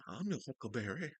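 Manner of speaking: put-on soft dandy accent; hushed Western dandy accent impression; Val Kilmer. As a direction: purr it quietly in a dandy accent. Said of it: "what that accent Val Kilmer was doing".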